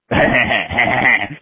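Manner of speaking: low, stuttering cartoon chuckle; Beavis and Butthead laugh. Imitating her creepy laugh, low cartoon snicker. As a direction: imitate a dopey repeated snicker. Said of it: "laughing like Beavis and Butthead".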